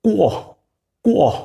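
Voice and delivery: কোয় said as a rounded kwa sound; q sound demo. Shown as a demonstration. Teacher drills students on Q sound, sounding out কোয়. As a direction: glide কোয় from k into a w. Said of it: "sound হচ্ছে".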